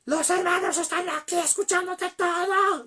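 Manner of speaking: very high, sustained loud yell; desperate shout. Retold or imitated. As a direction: act out a wife screaming at the end of her patience. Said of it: "Ya le gritó". (grito desesperado, muy agudo y sostenido)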